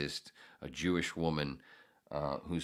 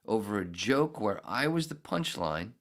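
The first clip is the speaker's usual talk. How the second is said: ordinary talk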